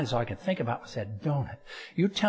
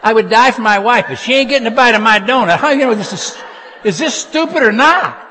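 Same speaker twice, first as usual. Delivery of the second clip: much higher and much louder, half laughing; worked-up preaching; 9 semitones higher, 13 dB louder than usual. Real, not acted. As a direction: shout it out to the crowd, carried away. Preacher worked up over donut.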